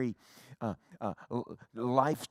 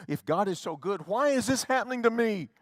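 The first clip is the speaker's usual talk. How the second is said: much louder and higher, building fervent sermon cry; full-force preaching; 9 semitones higher, 8 dB louder than usual. Acted. Preacher to his congregation, swelling into loud fervent preaching. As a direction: rouse the whole congregation, climbing each question.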